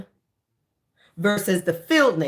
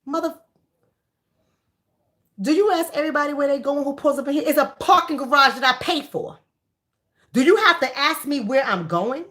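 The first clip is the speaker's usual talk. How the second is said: sassy comeback; 6 semitones higher than usual. Pretend. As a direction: snap back at him in the voice she wished she used. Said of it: "I want to be like".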